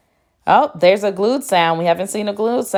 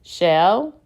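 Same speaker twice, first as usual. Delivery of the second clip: normal talking voice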